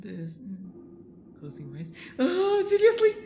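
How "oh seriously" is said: high, cracking cry of alarm; frightened cry. Real, not acted. Streamer cries out at a jump scare, high frightened yelp.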